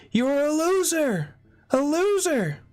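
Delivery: high-pitched shrieking taunt, drawn out; screaming. Retold or imitated. Unit patient Linda taunting him, shrill high scream. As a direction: screech it at him like a mad heckler. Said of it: "screaming at me".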